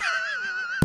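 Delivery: very high, loud mock cackle; exaggerated high squeal of laughter; imitated audience laughter. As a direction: shriek with laughter like a delighted crowd. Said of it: "everyone was like".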